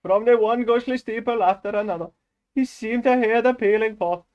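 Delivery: put-on high, loud Indian-accented reading. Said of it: "before Indian came out".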